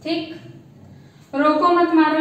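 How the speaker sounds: ordinary talk, nothing marked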